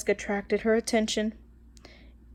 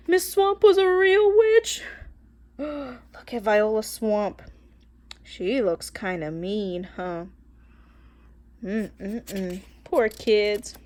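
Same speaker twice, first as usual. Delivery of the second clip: usual speaking voice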